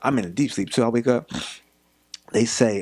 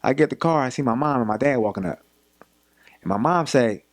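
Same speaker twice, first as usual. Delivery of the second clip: usual speaking voice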